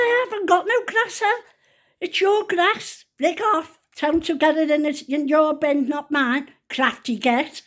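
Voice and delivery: re-enacted squabble with a neighbour; retold indignant retort. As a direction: argue back in exasperation. Retold bickering back at neighbour.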